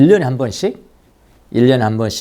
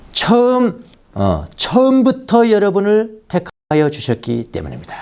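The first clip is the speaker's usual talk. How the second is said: scripture reading; 10 semitones higher, slower than usual. Acted. Reading scripture verse emphatically.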